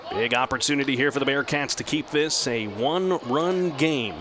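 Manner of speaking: ordinary talk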